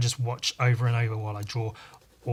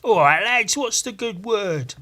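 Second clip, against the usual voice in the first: loud, high hammed-up Australian accent; 8 semitones higher, 11 dB louder, slower than usual. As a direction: shout it in broad Australian accent. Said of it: "That's my Australian accent".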